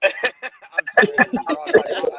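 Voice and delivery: high, helpless laugh through the words; real laughter breaking up the speech; laughing apology. Real, not acted. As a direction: apologise while cracking up. Host cracks up while apologising.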